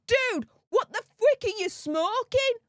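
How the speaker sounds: high, mock-incredulous scoff; incredulous audience member. Audience member scoffing at the cabinet minister, high incredulous tone. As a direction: toss it off like a stunned heckler, pitched high. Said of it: "They were turning around and saying".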